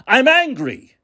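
high, insistent declaration of anger; real anger. Real, not acted. Host telling viewers how angry he is, voice rising high. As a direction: say it straight, pitch jumping up. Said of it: "I am angry".

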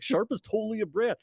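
high-pitched imitation of an indignant yell; high, mock-outraged protest; mock listener yell. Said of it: "like, yelling at the podcast".

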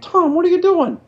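can't believe he's operating; shocked disbelief. Retold or imitated. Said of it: "I'm like".

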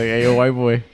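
a lightly voiced foreign boss calling out; mock Japanese man. Retelling a boss's nickname for him, lightly voiced caricature. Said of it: "a Japanese man who's just be like".

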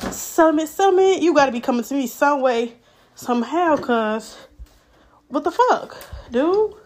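loud, high-pitched impatient plea; worked-up demand. Acted. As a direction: demand it from the mailbox, all fired up. Acts demanding settlement from the mail.

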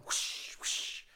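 breathy whoosh of skis on snow; ski whoosh noise. Retold or imitated. Mimicking a classmate skiing on a stool, whoosh noise. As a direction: make the swoosh of a skier.

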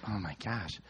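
low, soft disbelief; weary exasperation. Retold or imitated. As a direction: say it low, under your breath. Dad reacting to bickering kids.

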